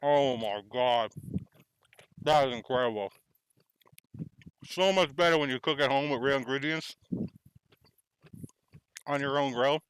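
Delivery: talking through a mouthful; chewing while talking; mouth full. Real, not acted. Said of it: "Sorry for talking with my mouth full".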